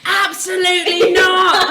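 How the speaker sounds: drawn-out, firm no; flat refusal, laughing; mock firm refusal. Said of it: "He was like".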